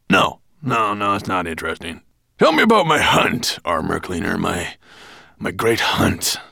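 loud, demanding dragon voice; imperious dragon. A narrator's reading. Dragon demanding the tale of his hunt, loud and imperious. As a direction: brush it off, then command loudly.

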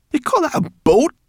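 very high, loud scornful shock; horrified disbelief. Acted. Brownie horrified at the boy's tiny boat. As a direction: recoil at the sight of it. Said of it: "said Sorrel, horrified".

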